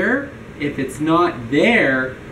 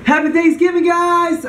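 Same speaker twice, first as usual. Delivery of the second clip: cheerful shout; 10 semitones higher than usual. Real, not acted.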